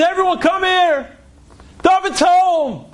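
high-pitched, joyful summons; acted mother announcing a homecoming; excited calling out. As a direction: call the whole family over, pitch high. Retold mother calls the family.

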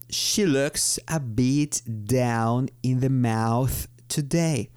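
very slow, deliberate English sentence; slow model sentence. Shown as a demonstration. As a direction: read the example slowly for learners. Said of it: "Por ejemplo, digo". (ejemplo leído muy despacio)

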